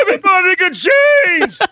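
high tearful shout as the boxer; Rocky speech impression. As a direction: cry it out like a triumphant fighter. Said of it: "they all watch Rocky".

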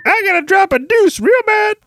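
high squeaky desperate whine. Acted. Acted desperate alien.

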